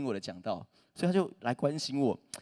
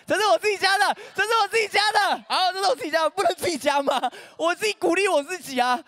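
higher, louder mock protest, hammed-up; mock indignant; 6 dB louder than usual. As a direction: playfully insist it was his own line. Defending his own joke.

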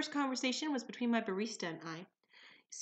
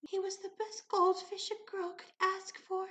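higher, slowish mock-mournful girl voice; imitating somber barista; 9 semitones higher, slower than usual. Barista mourning her goldfish, high mock-somber. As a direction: mourn a goldfish with a straight face. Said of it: "in the most somber voice ever".

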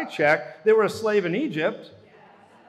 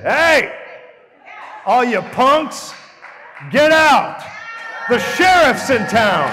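loud, higher shout, slow and commanding; bold shout; 6 semitones higher, 9 dB louder, slower than usual. Retold or imitated. Preacher voices Jesus driving out the punks, loud commanding shout. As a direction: bellow it like the sheriff just rode into town. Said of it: "Jesus came in and said".

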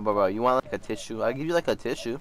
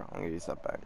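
much softer, breathy aside; hushed repeat as if to himself; whisper; 10 semitones lower, 15 dB quieter, much faster than usual. Real, not acted. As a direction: say it again under your breath. Repeats it under his breath.

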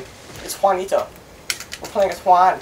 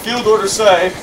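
usual speaking voice

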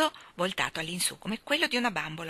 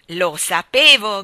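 very loud, drawn-out cry; exultant shout; 14 dB louder, slower than usual. A narrator's reading. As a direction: shout it slowly at full voice. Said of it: "gridò Michael". (grido forte e prolungato)

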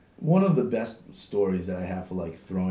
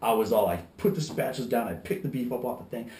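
normal talking voice

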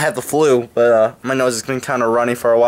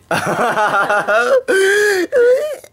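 shrieking laugh. Real, not acted. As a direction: burst out in a squealing laugh. Teasing Sabrina about leprosy.

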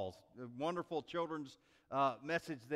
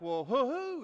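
higher, louder puzzled questioning; acted boss losing patience on the phone; exasperated boss; 9 semitones higher, 6 dB louder than usual.

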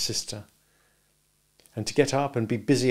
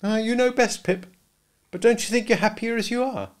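put-on higher young woman, gently doubtful; Biddy warning Pip off being a gentleman, higher voice; Biddy's voice; 12 semitones higher, 3 dB louder than usual. A narrator's reading. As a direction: voice Biddy a good deal higher, kindly advising.

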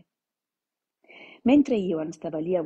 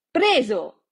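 sudden loud gotcha shout, higher; 4 semitones higher, 8 dB louder than usual. Acted. Leo grabbing Ioan's legs, loud gotcha shout. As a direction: jump out and yell it to scare him. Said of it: "gridò la voce".

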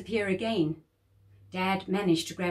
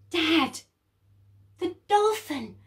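high, urgent cry to dad; excited call; 9 semitones higher, 4 dB louder than usual. Acted. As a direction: point it out to dad, thrilled. Jersey calling to Dad.

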